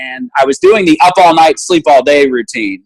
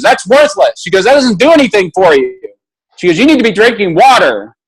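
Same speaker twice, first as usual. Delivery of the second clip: scolding nurse; 8 semitones higher than usual. Retold or imitated. Nurse lecturing patient.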